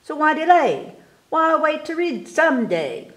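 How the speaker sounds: high, loud eager push; rally the kids to read now; excited urging. Acted. Urging the children to read today, high excited call.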